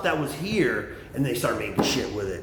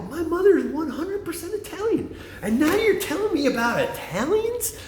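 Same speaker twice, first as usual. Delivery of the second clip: high, mock-outraged comeback; hammed-up offended retort; retold indignation; 11 semitones higher than usual. Retelling his reply to a friend about Italians, high and outraged. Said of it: "I go".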